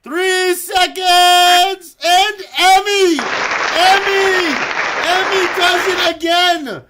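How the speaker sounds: high, full-voiced commentator shout; screaming race-climax call; excited finish call. Real, not acted. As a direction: belt out the winner with stretched words.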